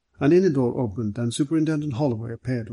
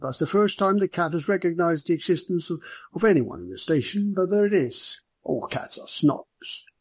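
higher-pitched, amused police chief; genial police superintendent, amused; 6 semitones higher than usual. Acted. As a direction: marvel lightly as a genial superintendent. Narrator voices amused superintendent.

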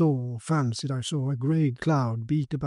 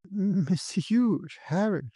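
slow, higher-pitched call; mild cry; 6 semitones higher, much slower than usual. A narrator's reading. Viola calling to her lover, mild higher cry. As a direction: call out haltingly with a touch of feeling. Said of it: "she cried".

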